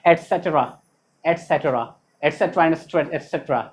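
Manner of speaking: ETCETERA as a low et-set-ra; full form. Shown as a demonstration. Teacher drills the spoken form, एटसेटरा as "et-set-ra". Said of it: "क्या बोला जाएगा?".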